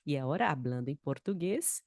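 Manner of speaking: normal talking voice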